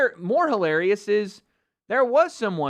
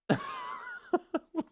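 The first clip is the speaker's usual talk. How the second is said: high mocking laugh; 7 dB quieter than usual. Real, not acted. Mocking tattoo.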